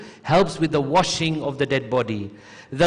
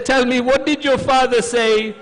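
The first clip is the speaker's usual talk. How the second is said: high, raised voice; urgent questioning; 8 semitones higher, 5 dB louder than usual. Retold or imitated. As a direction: ask with loud, pleading urgency. Preacher voices Zainab's urgent question.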